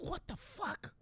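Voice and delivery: very high, hushed disbelief; stunned boss. Acted. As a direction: squeak it softly in mock shock. Boss stunned by employee's outfit.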